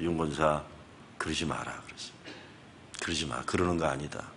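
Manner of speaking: low, soft pastoral rebuke; quiet admonishing. Retold or imitated. Pastor quietly scolding a church elder, soft rebuke. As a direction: gently scold the elder, hushed.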